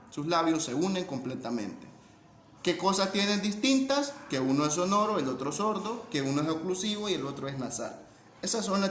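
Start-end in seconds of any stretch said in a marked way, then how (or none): none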